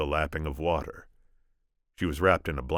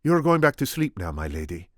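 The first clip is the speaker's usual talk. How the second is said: put-on lightly Spanish-accented Inigo; gentle Spanish accent; 4 semitones higher, 6 dB louder than usual. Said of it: "my attempt at Inigo".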